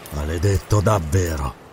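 low, dark murmur; low, brooding character voice; grim reply. Acted. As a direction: answer grimly, dropped well down in pitch.

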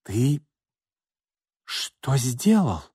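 breathless, slow outrage; aghast. Acted. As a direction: gasp it in indignation. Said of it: "задохнулась от возмущения София".

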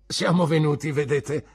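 put-on cheerful old sailor explaining; hearty fisherman. Peggotty speaks. (vecchio marinaio cordiale, più alto)

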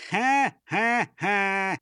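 slow, loud stage ha-ha-ha; mocking laugh. A narrator's reading. Chief laughs at would-be salesman, slow loud mocking laugh. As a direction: laugh in separate drawn-out bursts. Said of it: "рассмеялся шеф".

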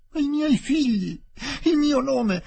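high, panting anguished plea; a broken man gasping for his family; breathless despair. Acted. Aziz being arrested, high and breathless. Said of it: "disse lui ansante".

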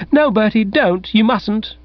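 put-on high, pleading cry of a young woman; young woman begging Bertie to stay, high and pleading; pleading yowl. A narrator's reading. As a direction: beg him not to go, voice rising. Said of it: "with a pleading yowl".

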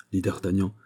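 ordinary delivery

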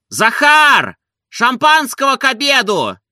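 high, loud call to a servant; excited shout. A narrator's reading. Master calls his servant for champagne, excited shout. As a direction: shout for Zakhar in high celebration. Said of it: "закричал он".

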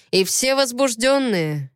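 usual speaking voice

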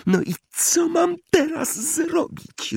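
very high, drawn-out squeak; squeaky voice. A narrator's reading. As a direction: ask it in a helpless, slow squeal. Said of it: "zapytała piskliwym głosem".

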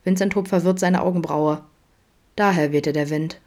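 ordinary delivery

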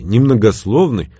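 usual speaking voice

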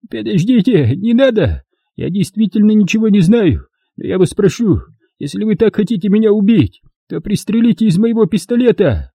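high, frantic begging; desperate plea. A narrator's reading. Trader pleading with his interrogators, frantic. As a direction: plead to be shot instead.

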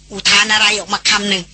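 ordinary delivery

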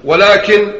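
normal talking voice

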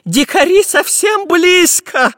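very high, drawn-out loud alarm; panicked pleading. A narrator's reading. Professor as the savages close in, pleading in panic. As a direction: wail it in rising panic. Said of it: "взмолился профессор Пыхтелкин".

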